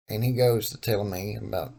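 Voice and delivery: ordinary talk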